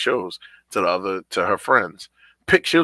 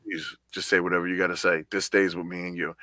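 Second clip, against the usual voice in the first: softer, lower, gentle coaxing; reassuring; 4 semitones lower, 5 dB quieter than usual. Retold or imitated. Dad reassuring his upset daughter, gentle and soft. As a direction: ease her into talking. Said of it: "I reassure her".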